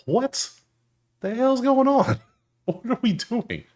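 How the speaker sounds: high, slow, incredulous squeal; stunned disbelief. Real, not acted. Bettor stunned by batting numbers against Scooble, high incredulous cry.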